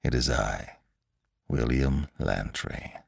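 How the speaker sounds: low, soft, deadpan self-introduction; dry. A narrator's reading. Lantry confirms who he is to the scientist, dryly. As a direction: announce yourself drily, unhurried and low.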